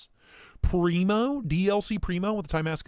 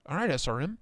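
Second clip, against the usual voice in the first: ordinary delivery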